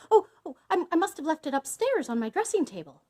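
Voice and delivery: high, rushed, stammering wife; flustered excuse. A narrator's reading. Wife stalls, hiding the key.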